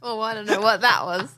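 ordinary talk

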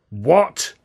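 sharp, loud startled bark; a mild mock roar; hammed-up shouted what. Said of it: "Alf roared".

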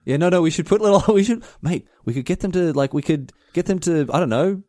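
normal talking voice

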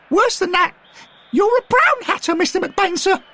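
put-on very high, loud boyish crow; young Derek, triumphant. A narrator's reading. Derek gloats over McBain in the mud, very high and loud. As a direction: crow over the caught traitor.

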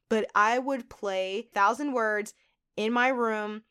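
ordinary talk, nothing marked

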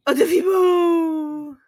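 loud, drawn-out pet-name coo; cooing call. Real, not acted. Host fussing over her needy dog, cooing loudly. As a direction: call the dog with long, stretched vowels.

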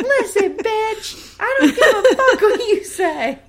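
loud, high-pitched hammed-up yelling; mock furious shout. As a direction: yell back in a trashy quarrel. [name] yelling back in acted quarrel.

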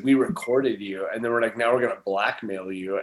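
low, hushed threat; sinister sister. Retold or imitated. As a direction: quietly menace a little brother. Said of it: "they said".

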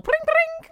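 high chirpy pring pring; bike bell imitation. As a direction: ring it like a little bell.

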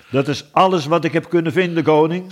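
high, quick servant's report; reporting messenger. A narrator's reading. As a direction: report briskly to the king. Messenger reports back to the king.

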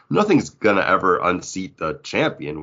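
usual speaking voice

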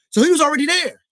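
high, loud burst of vindication; exclaiming shout. Real, not acted. Host finding Drexler was already in Houston, loud exclamation. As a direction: shout it out high, making the point.

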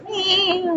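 small, high, soft meow; cat meow. Retold or imitated. Cat looking up at its annoyed owner, soft high meow. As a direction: imitate a cat's little mew, high and quiet. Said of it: "she looks at me and she goes".

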